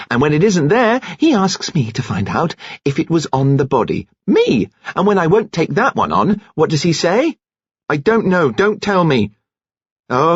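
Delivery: high-pitched indignant rant; bitter outburst. A narrator's reading. Jilted woman rants to the nurse, bitterly. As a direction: pour out the grievance, pitch leaping on key words.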